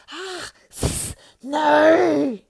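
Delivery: high, very loud frightened cry; hissing shriek. A narrator's reading. Gollum sees Sam's fire, shrieks in fright and anger. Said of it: "hissing shriek, seemed both frightened and angry".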